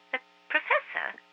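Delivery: put-on high astonished boy; surprised boy. A narrator's reading. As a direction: gape at the news. Marcus surprised.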